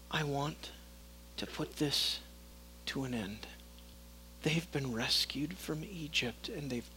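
low, hushed, fed-up fury; a dark whisper of divine anger; hushed furious God. Acted. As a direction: speak God's anger barely above a whisper.

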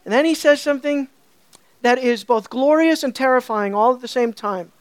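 ordinary delivery

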